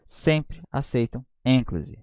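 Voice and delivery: "Sempre aceitam ênclise" dictated very slowly for note-taking; slow dictation. Shown as a demonstration. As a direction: dictate it slowly so they can write. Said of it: "Coloca aí".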